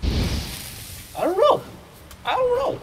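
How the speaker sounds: very high squeaky voice; baffled falsetto squeal; silly bewilderment. Pretend. Player unsure what is happening, silly squeaky bewilderment.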